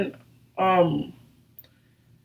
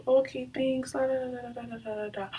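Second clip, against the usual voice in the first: soft, higher-pitched trailing da-da-da; dismissive singsong; 5 semitones higher, 11 dB quieter than usual. Retold or imitated. Girl half-listening to his sweet lies, dismissive singsong fading out. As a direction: fade away in a little brush-off voice.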